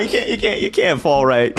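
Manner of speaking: ordinary talk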